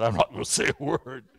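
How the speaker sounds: low, subdued acted surrender; mildly sheepish low voice; meek backing down. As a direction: drop low, as if giving up the argument. Job quoted, wisely shutting up.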